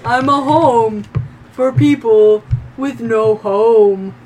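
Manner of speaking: lightly voiced slow old tree. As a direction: speak slowly, like an ancient tree.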